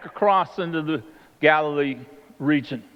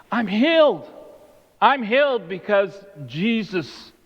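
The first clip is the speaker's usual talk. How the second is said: high-pitched, emphatic testimony; joyful proclamation; 5 semitones higher, slower than usual. Acted. Healed woman tells her hometown, joyful. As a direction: proclaim it to the whole town, acted out.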